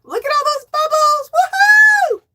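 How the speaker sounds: high excited shout ending in a whoop; cheering. Real, not acted. Streamer celebrating a donation with bubbles, cheering. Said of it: "I love when you cheer".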